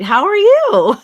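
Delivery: laughing greeting. Real, not acted. Greeting viewer while laughing.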